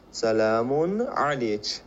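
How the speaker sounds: very slow سلام علیک, ending "alech"; slow Arabic demo of سلام علیک with "ch" ending; slow feminine greeting. Shown as a demonstration. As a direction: stretch out سلام علیک with a final "ch".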